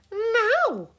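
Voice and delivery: high loud call; granny calling loudly. A narrator's reading. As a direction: call the children over, bossy. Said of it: "called Granny Island".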